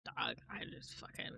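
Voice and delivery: soft unvoiced whisper. Real, not acted. Telling chat about her awful day, whispering. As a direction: breathe it out, spent.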